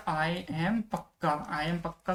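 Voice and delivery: slow, drawn-out I am पक्का with weight on am; slow mnemonic line. Shown as a demonstration. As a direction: say I am पक्का slowly, leaning on am. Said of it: "तो impeccable का मतलब क्या हो गया?".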